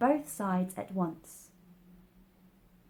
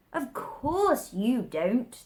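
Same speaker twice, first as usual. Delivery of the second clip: slow, scornful Hatter; contemptuous; 5 semitones higher, 5 dB louder, much slower than usual. Acted. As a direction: look down on her, drawing it out slowly. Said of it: "tossing his head contemptuously".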